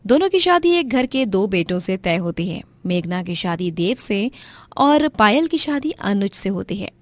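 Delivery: plain reading voice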